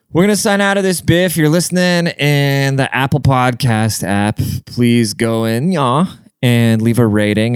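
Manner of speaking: upbeat sign-off read, slowing on the ask; hosty outro call to rate; podcast outro. Acted. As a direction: wrap up the show for listeners.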